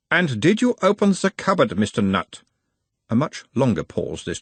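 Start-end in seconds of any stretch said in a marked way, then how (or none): 0.1-2.4 put-on high, probing analyst in Uberwald accent; Uberwald-accented therapist, pitched well up; mild Uberwald accent. A narrator's reading. Nutt as analyst asks about his mother, Uberwald accent. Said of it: "asked in a mild Uberwaldian accent".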